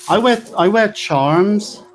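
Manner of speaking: put-on high, slow West Country accent. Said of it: "I'm bringing out my West Country accent there".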